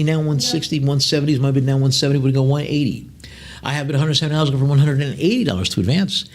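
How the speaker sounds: rhythmic, rising auction chant, pushing for the next bid; lively auction patter; auctioneer bid call. Acted. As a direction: keep the bids rolling like an auctioneer. Auctioneer calling bids.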